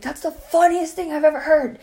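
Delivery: high, breath-short amusement; imitating someone in hysterics; retold hilarity. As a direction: say it high, as if cracking up. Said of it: "laughed his ass off".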